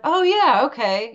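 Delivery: put-on high, eager child's voice; childlike trust. Daughter agreeing to trust her mom, high and eager. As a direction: chirp it like a believing kid. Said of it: "childlike faith".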